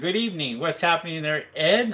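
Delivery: usual speaking voice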